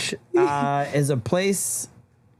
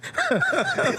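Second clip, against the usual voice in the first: high laugh. Real, not acted. Group laughing at joke.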